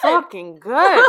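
slow, loud laugh through words. Real, not acted. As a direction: laugh it out, delighted. Tasting, bursts out laughing.

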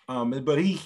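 usual speaking voice

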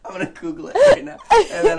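high, loud, laughing words; giggling through the line. Real, not acted. Laughing excited.

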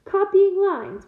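high, loud, drawn-out mocking echo; scornful growl. A narrator's reading. Gamekeeper repeating the student's complaint, scornful growl. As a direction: throw the phrase back slowly in disbelief. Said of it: "Hagrid growled".

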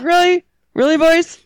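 high-pitched mock disbelief; incredulous. Retelling a reaction to friends' outing, incredulous. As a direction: pitch it up, questioning their choice.

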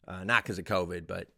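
ordinary talk

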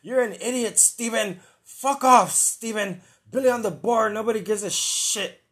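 high, loud mocking boss voice; imitating the boss. Host imitating the boss yelling at him, high mock rant. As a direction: yell it like the boss in his ear. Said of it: "He's just saying".

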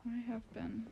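usual speaking voice

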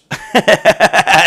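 high, rapid cackle; laugh. Real, not acted. Podcaster amused by his housemate's search, high laugh. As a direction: burst out laughing at the punchline.

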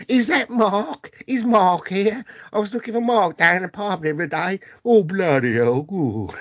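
mock EastEnders pub Cockney; bad Cockney accent. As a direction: ham up a Cockney soap character. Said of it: "real bad Cockney accent".

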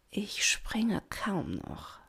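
slow, soft, drawn-out whisper; breathed whisper. A narrator's reading. Doña Rosa admits this after a while, whispering slowly. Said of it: "hauchte Doña Rosa".

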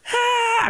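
high imitated sob-wail; imitating a crying boy on the ride; crying kid wail. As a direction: wail like a terrified child. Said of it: "acá llorando".

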